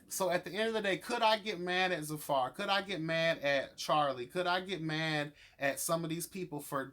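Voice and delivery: ordinary talk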